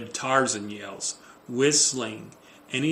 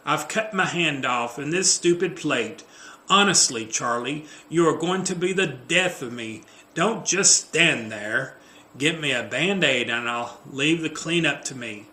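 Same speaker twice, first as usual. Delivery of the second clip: exasperated, dramatic complaint; mildly hammed-up exasperation; overwrought Jennifer; 4 semitones higher, 6 dB louder than usual. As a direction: play a put-upon, fussing partner. Script reading as Jennifer.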